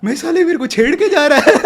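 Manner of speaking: cracking up at the absurdity; laughing. Real, not acted. Recalling being catcalled, laughing.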